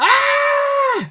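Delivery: very high startled scream; high-pitched shocked yelp. Retold or imitated. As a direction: let out a sudden shriek. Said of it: "Okuma screams out".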